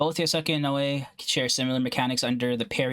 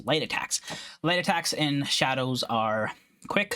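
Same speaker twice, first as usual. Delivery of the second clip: ordinary delivery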